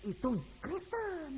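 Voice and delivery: mock outsider. Voicing mocking outsider.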